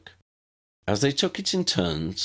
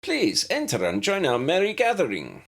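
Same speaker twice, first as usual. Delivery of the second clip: lilting, higher invitation; sing-song professor inviting visitors; musical voice; 11 semitones higher than usual. A narrator's reading. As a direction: welcome them in like a gracious host. Professor welcoming through door.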